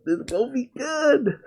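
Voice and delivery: high, quiet excited squeal; giddy excitement. Real, not acted. Fan repeating himself about the fight, giddy high squeal. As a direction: squeak it out high and soft, thrilled.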